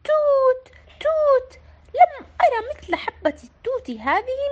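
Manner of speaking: bright, high delighted exclaiming; joyful cry. A narrator's reading. Sara finding the berry, calling out with joy. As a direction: squeal over the berry with joy. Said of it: "فصاحت سارة بفرح".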